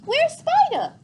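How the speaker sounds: put-on high, loud little-girl chirp; cheerful toddler. A narrator's reading. Boots asking cheerfully about the spider, high toddler voice. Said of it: "said cheerfully".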